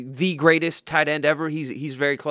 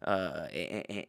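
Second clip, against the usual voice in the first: ordinary talk, nothing marked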